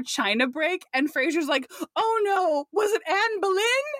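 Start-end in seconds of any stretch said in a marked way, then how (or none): 2.0-3.9 high-pitched, hammed-up dismay; mock alarm. Frasier hearing his china break, mock alarmed. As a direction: imitate a worried china collector, pitched up.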